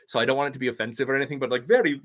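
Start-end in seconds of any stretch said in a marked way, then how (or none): none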